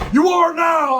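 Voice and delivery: high, loud overriding shout; imitated biker bellowing; retold screaming. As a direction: yell it to settle the matter. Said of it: "the whole time he's fucking screaming".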